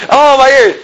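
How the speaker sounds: high, hammed-up wail of pain; exaggerated high-pitched protest; mock complaining. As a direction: whine as if deafened. Mimics listener hurt by the shouting.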